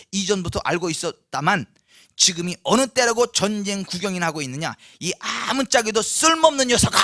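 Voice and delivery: loud acted tirade of an older brother; angry scolding. Eldest brother berating David at the battlefield, acted angry scolding. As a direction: lay into him, building to a shouted insult. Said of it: "뭐 화를 내면서".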